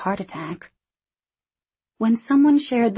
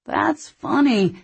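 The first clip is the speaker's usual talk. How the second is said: drawn out, pitched high; slow and deliberate; 8 semitones higher, 3 dB louder, slower than usual. A narrator's reading. Rory finally answering Hank in the silent room, slowly and deliberately. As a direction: take your time, pointedly. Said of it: "he said slowly".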